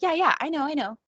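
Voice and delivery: high-pitched, brushing-it-off know-it-all; mock overconfidence. Speaker voicing listener's thought, mock overconfident dismissal. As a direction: wave it away as already known. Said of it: "how often do you think".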